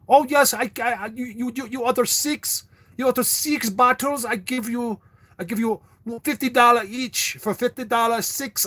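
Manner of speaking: high, sing-song mock Indian accent. Mimicking the phone salesman's Indian accent to viewers. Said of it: "freaking Hindus".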